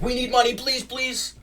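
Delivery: high-pitched mock plea; mock begging. Mimicking a commentator asking for money, mock begging.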